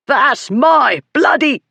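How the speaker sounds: high, drawn-out yell, cut off; bully's strained, high protest; outraged shout. A narrator's reading. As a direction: shout furiously until interrupted.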